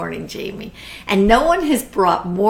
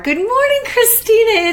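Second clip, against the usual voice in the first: bright excited greeting; 5 dB louder than usual. Real, not acted. Warmly greeting a friend online.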